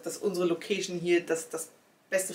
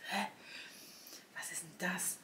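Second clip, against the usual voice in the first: whispered confused question; whispered puzzlement; 16 dB quieter than usual. Retold or imitated. Puzzling over the strange letter, whispered bafflement. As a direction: hush it, baffled.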